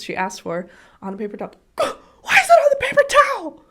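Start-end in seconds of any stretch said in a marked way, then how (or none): 1.8-3.6 very high, loud half-laughing shriek; child's scream. Retold or imitated. Mom imitating daughter screaming for underwear. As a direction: shriek it in full meltdown. Tantrum retold.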